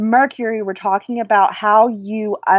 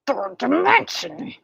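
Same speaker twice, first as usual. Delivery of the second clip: a slow, higher, lightly mocking echo of a phrase; mocking others; 6 semitones higher, 4 dB quieter than usual. Imitating what everyone keeps saying, slow and a touch mocking. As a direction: parrot the phrase slowly, a little mocking. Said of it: "everyone keeps saying".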